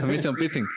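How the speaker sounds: "piercing" said with a lisp, like "pithing"; mock lisp on "piercing". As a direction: say "piercing" with the tongue lisping the s. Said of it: "me empezaba a hablar así".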